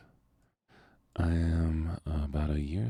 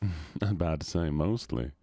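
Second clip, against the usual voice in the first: put-on Southern accent. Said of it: "get out of the southern voice".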